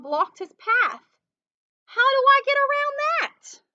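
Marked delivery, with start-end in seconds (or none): 1.8-3.6 high, loud squeaky mouse voice. Acted. Mouse blocked by the log, high squeaky dismay.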